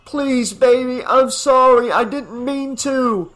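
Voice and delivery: high, loud pleading apology; exclaiming. A narrator's reading. Father pleading with daughter in canoe, loud exclamation. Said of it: "John exclaimed".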